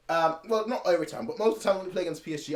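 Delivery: usual speaking voice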